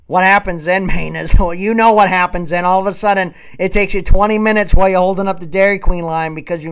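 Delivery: loud, driving tirade; loud comic tirade to Mainers; fired-up rant. Real, not acted. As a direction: preach it loudly to the listeners.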